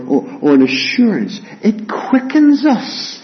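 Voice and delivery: impassioned high preaching. Acted. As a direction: preach it with full fervour. Preaching the sermon.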